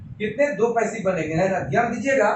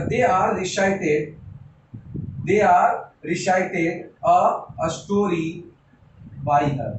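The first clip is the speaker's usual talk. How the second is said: slow dictation; 4 semitones lower, much slower than usual. Shown as a demonstration. Teacher writing the example.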